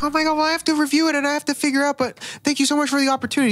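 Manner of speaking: high, fast breathless gushing; high hurried fake politeness; mock gushing thanks. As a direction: rush out the thanks. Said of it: "And she's like".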